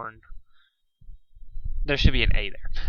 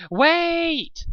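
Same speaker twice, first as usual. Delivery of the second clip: very high, loud cry to stop the fight; a shy voice finally shouting; sudden high shout; 4 dB louder than usual. A narrator's reading. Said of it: "shouted Fluttershy".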